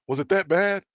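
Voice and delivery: retold surprise, jumping up in pitch; incredulous question. Retold supervisor's shocked reaction.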